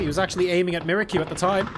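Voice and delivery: ordinary talk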